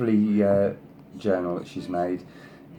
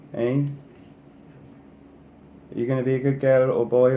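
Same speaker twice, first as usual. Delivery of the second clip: higher, louder sing-song coaxing of a pet; pet-talk, a little higher and brighter; coaxing the cat; 5 semitones higher, 4 dB louder than usual. Real, not acted. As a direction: sweet-talk the cat into behaving.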